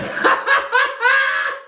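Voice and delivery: high shrieking laugh; burst of laughter. Real, not acted. Vlogger reacting to Erica's insult, shrieking with laughter. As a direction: cackle out loud at the burn.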